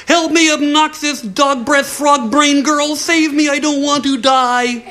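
high, frantic begging little man; desperate plea. Acted. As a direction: beg for your life. Dwarf begs for rescue.

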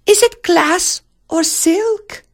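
slow, very high princess voice; cooing princess. Acted. Princess asks about the rose, cooing high. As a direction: ask it slowly in a high coo.

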